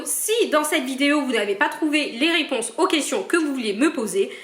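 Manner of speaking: normal talking voice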